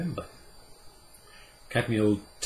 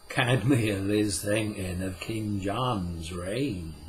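Puck, slow and solemn; slower than usual. Acted. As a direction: lay down the old law slowly and gravely. Puck's voice in the story.

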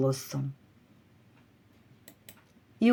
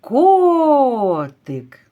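котик called high and loud, О held long; calling, stressed О; 5 semitones higher, 5 dB louder than usual. Shown as a demonstration. Teacher demonstrates the stressed О, calling котик with it stretched. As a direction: hold the first vowel of котик in a call. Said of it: "Тут наголошений".

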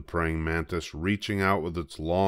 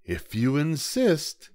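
hyena; 9 semitones higher, slower than usual. A narrator's reading. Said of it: "said the hyena".

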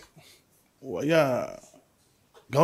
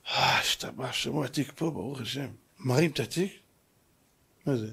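low, soft suspenseful storytelling; hushed story narration; 9 semitones lower, 10 dB quieter than usual. Acted. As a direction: drop to a quiet, low storyteller's voice. (סיפור בקול נמוך ושקט)

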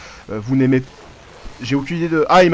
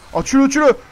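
excited urging; 9 semitones higher, 4 dB louder than usual. Real, not acted. Excited shouting during a capture.

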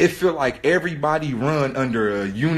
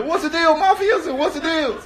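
high-pitched mock homie greeting; playful, pitched-up hollering; mock hearty greeting; 13 semitones higher than usual. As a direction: imitate excited pals hailing each other. Imitating homies' excited greetings.

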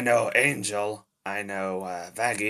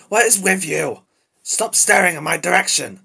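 loud, raised-pitch snapping; exasperated yell, pitched up; angry outburst; 7 semitones higher, 7 dB louder than usual. Acted. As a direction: lose your temper at being stared at. Loses temper at those staring.

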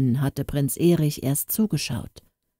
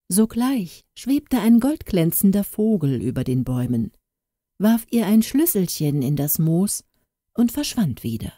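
plain reading voice

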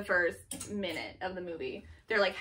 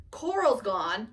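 usual speaking voice

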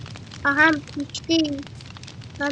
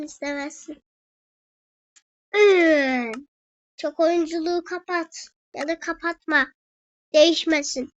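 ordinary talk

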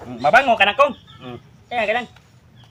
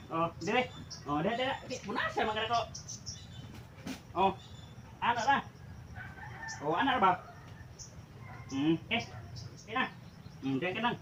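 soft coaxing; 16 dB quieter than usual. Real, not acted. Soothing the dog while drying.

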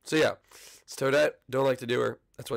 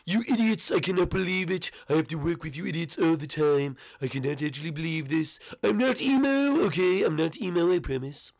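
exasperated, higher, Donald Trump-like rant; Ludwig; 5 semitones higher than usual. Shown as a demonstration. Ludwig berates the idiots he works with, exasperated. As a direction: complain indignantly, pitch jumping up. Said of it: "sort of like a Donald Trump".

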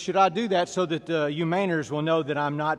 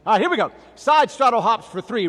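loud, high call to the class; exercise command; 5 semitones higher, 7 dB louder than usual. Real, not acted. Trainer launching side straddle hops, loud command. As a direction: announce the drill with force. Instructor calls out next exercise.

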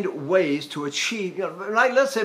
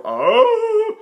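much higher, louder held oh; high women's oh; 7 dB louder, much slower than usual. Retold or imitated. Voice teacher imitating female singers. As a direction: sing it like the women do. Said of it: "the women go".

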